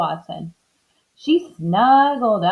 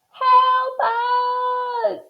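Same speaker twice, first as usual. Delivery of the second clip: high, drawn-out cry; calling for help. A narrator's reading. Missus Watson stuck in the hole, high call for help. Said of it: "Missus Watson called".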